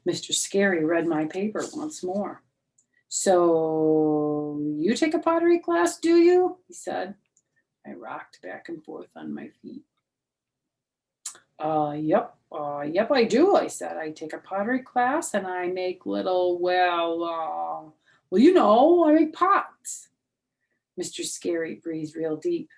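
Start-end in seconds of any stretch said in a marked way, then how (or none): 3.1-6.6 skeptical teacher. Acted. Teacher quizzing the child. 11.6-20.1 put-on flustered child. Child stammering a lie.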